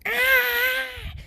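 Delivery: high angry yelp; a sharp high little war cry; high furious baby shriek. Retold or imitated. Deku angered, yells.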